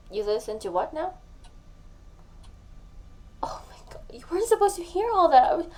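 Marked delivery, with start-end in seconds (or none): 3.4-4.0 a hushed, horrified gasp of words; whispered embarrassment on the phone; mortified whisper. Acted. Learns he heard the voicemails. 4.1-5.7 higher, louder and fast, in embarrassed panic; panicked, embarrassed outburst to her friend on the phone; flustered panic. Acted. Learns he heard the voicemails.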